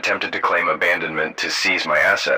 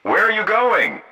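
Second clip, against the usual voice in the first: high-pitched shrieked question; understated narrated shriek; mild shriek; 14 semitones higher than usual. A narrator's reading. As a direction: shriek it high, without much volume. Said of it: "and shrieked".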